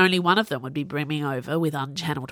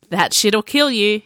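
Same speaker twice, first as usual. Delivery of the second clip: raised, louder warning; 4 semitones higher, 6 dB louder than usual. A narrator's reading. Sage to bros asking for troll, warning. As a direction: warn them off, lifting the voice. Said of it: "he warned".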